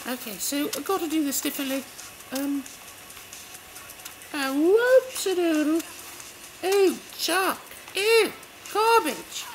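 high-pitched game heroine, chatty; lively high character voice; Faith voice. Acted. Returns to Faith voice.

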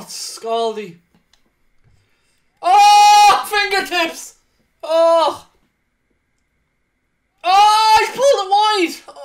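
loud, high near-miss cries; excited yelling. Real, not acted. Gamer reacting to near misses live, shouting excitedly. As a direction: react to every chance out loud.

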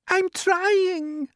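very high, loud little voice; poor glowworm. A narrator's reading. Glowworm pressed for light, high squealed protest. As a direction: squeal it out, put-upon. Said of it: "answered the poor glowworm".

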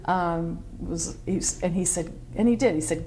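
ordinary delivery

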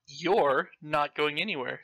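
normal talking voice

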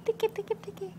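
high, singsong nursery chant; high sing-song baby talk. Acted.

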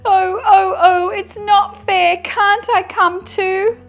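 high, loud mock sobbing; hammed-up crying with drawn-out whines; sobbing tantrum. A narrator's reading. Tortoise throws a tantrum at being left, mock sobbing. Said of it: "He sobbed, he sighed".

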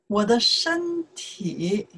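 normal talking voice

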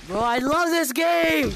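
slow, loud joyful cry; excited shout. Real, not acted. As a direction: shout your delight. Elated shout after scare.

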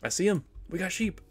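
high, loud thrilled cry; excited shout. Real, not acted. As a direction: yell it out, finally found them. Finding sheep at last.

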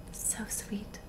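very soft, unhurried whisper; whispered savouring. Acted. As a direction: breathe it out as if savouring a scent. Edward savours her breath.

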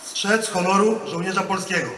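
loud, measured oath line; formal oath dictation. Real, not acted. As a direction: declaim each phrase for the ranks.

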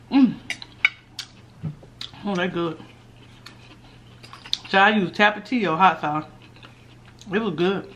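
talking while chewing; chewing greens. Real, not acted. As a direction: mouth full of food. Seen 1: Greens forked into mouth at -1.0; lips closed, chewing during words.

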